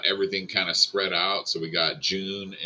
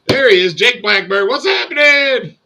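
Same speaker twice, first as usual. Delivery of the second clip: loud greeting; 12 dB louder than usual. Real, not acted. Greeting a stream guest loudly.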